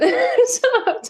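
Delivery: laughing. Real, not acted. Laughing at friend's teasing.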